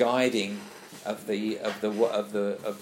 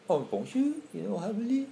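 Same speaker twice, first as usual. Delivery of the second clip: mock French, higher and sing-song; French accent; 9 semitones higher than usual. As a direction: imitate a French speaker's lilting tune. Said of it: "say, French".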